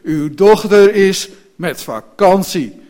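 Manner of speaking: loud, emphatic indignant coachman; really angry. A narrator's reading. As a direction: slam out each phrase in anger. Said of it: "werd nu echt boos". (luid, nadrukkelijk en echt boos)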